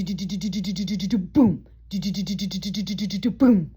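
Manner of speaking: fast do-do-do beats ending in boom; vocal rhythm strip. Shown as a demonstration. Teacher beatboxing the SVT rhythm for students. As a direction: sing out the heart rhythm. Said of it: "we've got do".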